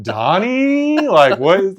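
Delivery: high, loud alarmed "Donnie?"; horrified discovery. Retold or imitated. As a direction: cry out as if finding a body. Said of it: "I'd be like".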